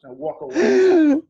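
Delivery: hammed-up haughty exit line; mock dismissive. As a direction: strut off in a haughty voice. Acting out walking off.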